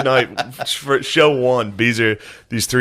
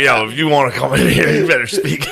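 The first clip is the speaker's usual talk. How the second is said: lightly voiced tough Philly counterman; a hint of a gruff sandwich-shop guy barking orders; full Tony Luke's; 2 semitones higher than usual. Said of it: "He'd go full Tony Luke's".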